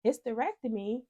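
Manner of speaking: high-pitched bewildered questions; retold panicky confusion; confused, freaking out. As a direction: fire back in alarmed disbelief, voice shooting up. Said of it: "I'm confused at this point".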